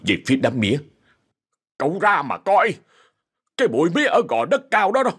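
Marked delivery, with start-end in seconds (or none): 1.8-5.1 higher, frightened old worker, lightly voiced; breathless fear. Old worker Chính warns the boss, breathless and scared. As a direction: gasp it out, scared to death. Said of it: "thở không ra hơi".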